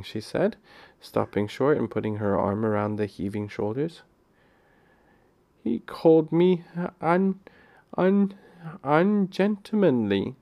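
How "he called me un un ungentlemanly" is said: a light sob, stammering over the word; sobbing. Acted. Phyllis to Peter, hurt by his insult, mildly sobbing. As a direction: stumble through it, fighting back tears. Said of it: "sobbed Phyllis".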